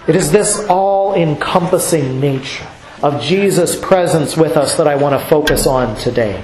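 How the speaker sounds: usual speaking voice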